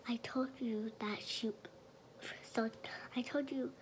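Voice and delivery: breathy secret-telling whisper. Real, not acted. Whispering to camera throughout.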